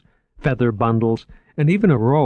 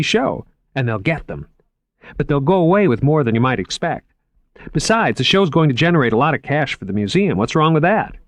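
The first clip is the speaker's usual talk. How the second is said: higher, keen salesman voice; lightly raised, enthusiastic museum man; eager curator Moriarty; 5 semitones higher, 4 dB louder than usual. Acted. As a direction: pitch the exhibit like an excited young curator. Moriarty defends show.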